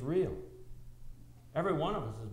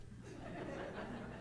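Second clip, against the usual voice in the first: quiet unvoiced chuckle; a soft chuckle at the joke; soft breathy laugh; 13 dB quieter than usual. Real, not acted. Laughs at own plan.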